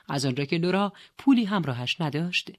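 ordinary delivery, nothing marked

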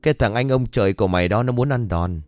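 plain reading voice

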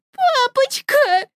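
put-on little girl calling daddy; frightened child. (высоким детским голосом)

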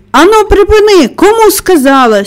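high, loud angry yell; full-force shout. A narrator's reading. Mary yelling at hysterical Colin to stop, shouting with all her might. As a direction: shout it right over his ear. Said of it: "з цілої сили крикнула вона".

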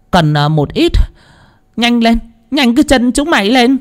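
high-pitched, commanding character voice; stern medium's voice. A narrator's reading. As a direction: give orders in a high, stern voice, sharper at the end. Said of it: "nghiêm giọng nói với đám lão Nhiên".

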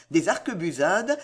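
ordinary delivery, nothing marked

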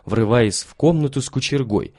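usual speaking voice

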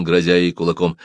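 ordinary delivery

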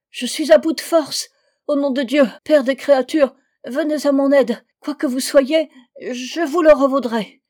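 high, loud moaning entreaty; wailing plea. A narrator's reading. Exhausted man begs for rescue from the well, wailing. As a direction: whine desperately for help. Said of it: "geignait de plus belle".

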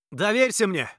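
loud high shout back. A narrator's reading. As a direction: call out over the wind. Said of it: "крикнул в ответ Аладдин".

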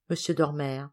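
plain reading voice

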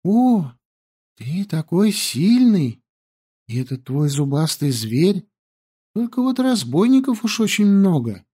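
high, sweet flattery; flattering girl voice. Acted. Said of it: "Yandi flattering".

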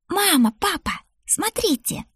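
put-on very high child voice, calling; excited little girl. A narrator's reading. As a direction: beg parents to look. Child calls her parents to look. (детский восторженный оклик)

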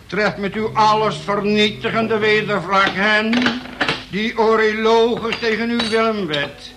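preaching at full force; fiery prayer. Acted. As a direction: call down wrath like a preacher. Prays aloud at the group.

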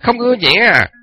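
loud, high angry threat; bully her with a loud angry voice. Acted. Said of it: "hầm hầm, chĩa vào mặt mụ".

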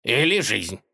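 teasing, needling question; mocking. A narrator's reading. Tsar needling the masked robber, mocking. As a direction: taunt him with the other option. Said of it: "с издёвкой уточнил".

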